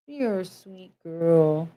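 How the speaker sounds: slow, soft praise for the dog; tender little praise to the dog; sweet pet talk. Real, not acted. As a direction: coo it gently to a pet.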